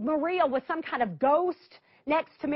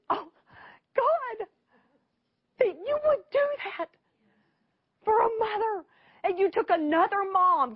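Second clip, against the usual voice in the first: high, crying voice breaking on each phrase; tearful prayer; 7 semitones higher than usual. Retold or imitated. Driving home after the call, crying out to God. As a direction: pray through tears of gratitude. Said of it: "I just bawled".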